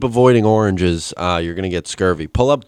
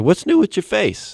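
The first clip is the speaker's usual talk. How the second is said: a lightly voiced lady, pitched higher; imitating the lady; 12 semitones higher than usual. Retelling the lab lady's question in a higher mock voice. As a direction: mimic the nosy old lady. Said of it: "She's like".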